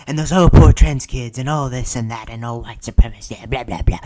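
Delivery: loud, dismissive mock concern; mocking rant. Former Democrat listing the party's concerns, loud and mocking. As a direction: rattle it off loudly with scornful fake pity.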